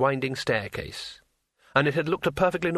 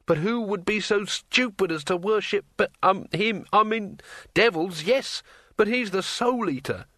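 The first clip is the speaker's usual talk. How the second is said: higher, hesitant nervous wizard; nervous Rincewind; 8 semitones higher than usual. A narrator's reading. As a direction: stammer it out a bit. Rincewind horrified.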